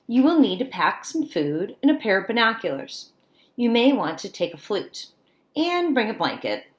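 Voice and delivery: bright storybook read-aloud; picture book read to kids; reading to children. Acted. Teacher reads picture book aloud.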